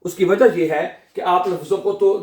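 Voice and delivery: ordinary talk, nothing marked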